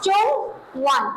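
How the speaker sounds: slow, careful चुंग वन with a clear u in चुंग; चुंग वन drawn out for students to repeat; model pronunciation. Shown as a demonstration. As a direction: say चुंग वन slowly, syllable by syllable, like a teacher. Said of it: "क्या पढ़ेंगे sir?".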